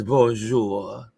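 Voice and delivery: Bonjour, mes amis read with a careful French greeting; attempted French. Reading a viewer's French greeting aloud, carefully. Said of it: "did I say it right".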